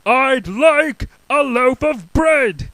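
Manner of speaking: loud, slow high shout. Acted. Mister Noisy shouting his order at the baker. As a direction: shout it slowly. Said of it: "Mister Noisy shouted".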